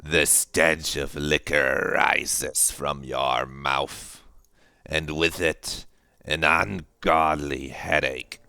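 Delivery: very slow, drawn-out narrator voice; game narration. Acted. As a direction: stretch out each ominous phrase. Voice-acts the game's narration.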